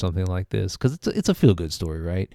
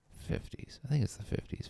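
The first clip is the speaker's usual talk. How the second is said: Fifties whispered under his breath; whisper to self; 4 semitones higher, 14 dB quieter than usual. Real, not acted. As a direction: whisper it to yourself, unsure. Muttering to himself, unsure.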